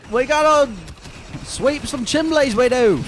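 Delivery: high, slow Cockney chimney sweep; hammed-up Victorian Cockney accent; old london cockney. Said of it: "Victorian Cockney accent instead of New Zealand".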